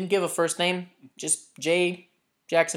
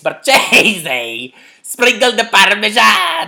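slow, loud mock Italian accent; over-the-top fake Italian accent, stretched vowels; Inglourious Basterds Italian; 5 semitones higher, 8 dB louder, slower than usual. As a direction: drag out Marchese in a hammy Italian accent. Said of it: "I'm the guy in, uh, shit, Inglourious Bastards".

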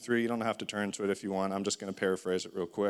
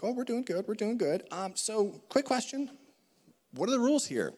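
naive high voice; 11 semitones higher than usual. Acted. Adam and Eve answer snake.

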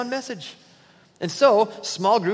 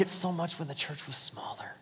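whispered complaint; 12 dB quieter than usual. Retold or imitated. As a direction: breathe it like a secret grumble.

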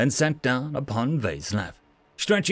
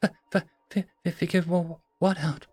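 softer, stuttering in fear; nervous stutter; 3 semitones higher, 4 dB quieter than usual. Acted. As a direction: trip over the words nervously. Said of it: "whiskers twitching nervously".

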